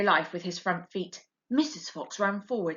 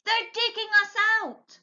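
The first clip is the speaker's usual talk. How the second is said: shouting; 14 semitones higher than usual. A narrator's reading. Mr Fox waking his family as diggers come, shouting. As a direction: yell it to wake the den. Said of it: "he shouted".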